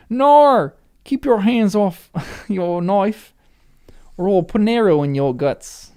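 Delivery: hammed-up Australian accent, loud and high; broad mock-Australian orc, breaking into a laugh; terrible Australian accent. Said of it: "That's a terrible Australian accent".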